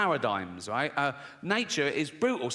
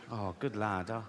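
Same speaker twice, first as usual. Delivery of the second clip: pleased dad; 8 semitones lower, 6 dB quieter than usual. Retold or imitated.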